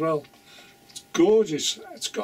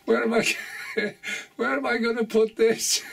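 cracking up while talking, higher pitch; can barely ask it for laughing; real giggling; 6 semitones higher than usual. Real, not acted. Showing viewers the daughter's cheeky gift, giggling.

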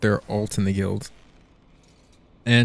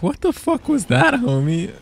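mock startled reaction; 11 semitones higher than usual. Voices a friend's startled reaction.

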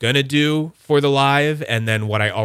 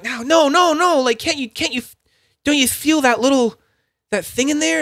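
very high, squeaky mock pleading; pleading protest; 4 dB louder than usual. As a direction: plead in an exaggerated falsetto, desperate to be understood. Acts out frustrated plea to indifferent people.

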